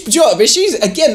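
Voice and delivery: ordinary talk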